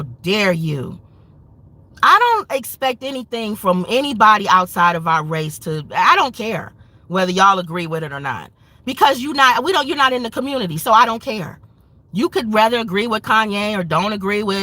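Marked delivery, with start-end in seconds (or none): none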